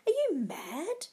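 high, incredulous question; amazed gasp. A narrator's reading. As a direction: ask in sheer amazement. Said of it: "gasped in amazement".

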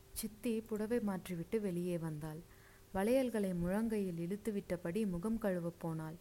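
ordinary delivery

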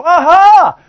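high, loud triumphant aha; a hammed-up eureka; mock discovery. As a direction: crow like you just cracked the riddle. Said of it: "we go".

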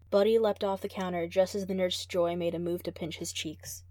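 usual speaking voice

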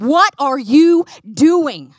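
very loud, slow, booming demand; righteous anger. Acted. Prophet confronting a king who wronged God, booming anger. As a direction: thunder each word out slowly. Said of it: "and he was angry".

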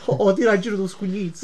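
hammed-up Neapolitan street talk; neapolitan street slang. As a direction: tough Neapolitan street kid voice. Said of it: "non essere contro la mia lingua".

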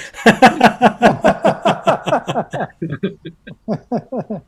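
loud, high peals of laughter; long high laugh. Real, not acted. Guest amused by her French-accent joke, loud high laughter. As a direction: let a big laugh roll out.

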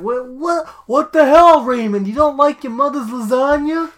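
loud, high exasperated sitcom impression; Everybody Loves Raymond father. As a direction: burst out indignantly, hammed-up. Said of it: "expecting them to come out like".

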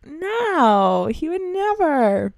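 mock naive girl; imitating my younger self; naive denial. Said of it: "I was like".

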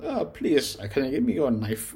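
playful put-on British voice, overly polite; British accent. Reviewer mimicking a British song intro in a mock-polite British accent. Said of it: "a British way of saying".

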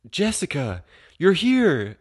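high-pitched excited greeting, rising in volume; overjoyed, hammed-up call; mock delighted recognition. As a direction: act out a lovestruck boyfriend finding her. Billy spots Jessica and gets out.

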